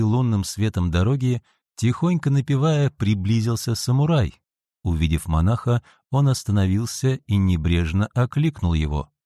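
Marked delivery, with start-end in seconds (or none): none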